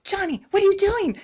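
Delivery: hammed-up scolding parent. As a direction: call out the kid like a shocked parent. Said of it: "the parent's like".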